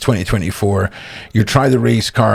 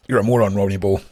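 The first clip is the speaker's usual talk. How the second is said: ordinary talk, nothing marked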